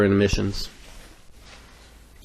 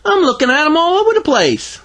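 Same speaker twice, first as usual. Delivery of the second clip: put-on very high, perky child voice; cheerful little girl; 4 dB louder than usual. A narrator's reading. Greta answers the undertaker, high cheerful kid voice. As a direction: pipe up brightly, high-pitched. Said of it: "Greta cheerfully responded".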